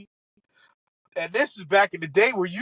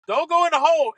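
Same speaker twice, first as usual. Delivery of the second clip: higher, louder plea at the ball; urging the ball; 9 semitones higher, 8 dB louder than usual. Retold or imitated. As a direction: yell at the ball not to drop. Watching the shot fly.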